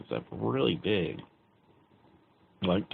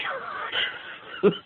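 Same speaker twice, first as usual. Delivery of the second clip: real giggle, breathy and high. Real, not acted. Laughing at the memory of the spinning chair, giggling high. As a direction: let a giggle burst out.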